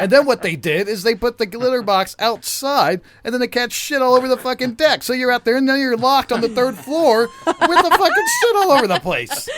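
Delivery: loud, high-pitched exasperation; worked-up comic tirade; heated rant. Real, not acted. Ranting about the litter box quarrel.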